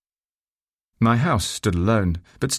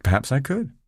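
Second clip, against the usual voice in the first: coy, higher-pitched reply; lightly teasing, pitched up; somewhat coquettish; 6 semitones higher than usual. A narrator's reading. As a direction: flirt back a little. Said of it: "somewhat coquettish, I thought".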